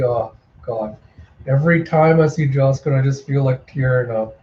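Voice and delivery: plain reading voice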